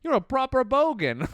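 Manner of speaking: hammed-up Australian mate declaring it; Australian accent. Australian guy calling the comedian a proper bogan, hammed-up accent. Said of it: "he's just like".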